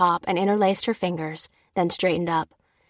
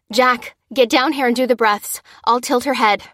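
loud, raised-pitch instructions; pressing, commanding delivery; urgent order; 5 semitones higher, 5 dB louder than usual. A narrator's reading. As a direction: take charge in an emergency. Ordering boy to help with CPR.

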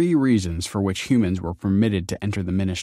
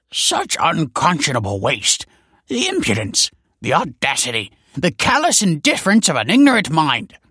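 high-pitched indignant tirade; building, high-voiced outrage; outraged scholar; 8 semitones higher, 5 dB louder than usual. A narrator's reading. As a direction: rant in affronted scholarly disbelief. Scholar rants at the boy.

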